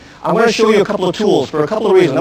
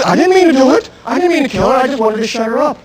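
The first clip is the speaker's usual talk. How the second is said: pleading attacker; 7 semitones higher than usual. Retold or imitated. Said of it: "So he'll tell me".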